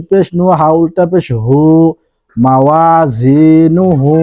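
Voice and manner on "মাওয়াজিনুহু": মাওয়াজিনুহু drawn out slowly, syllable by syllable; slow, careful recitation of মাওয়াজিনুহু. Shown as a demonstration. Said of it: "spelling then reciting the word".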